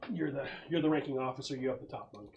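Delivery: ordinary talk, nothing marked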